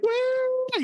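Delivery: very high drawn-out woo; drunk party woo. Retold or imitated. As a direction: squeal it like a drunk bachelorette. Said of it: "like drunk soccer moms".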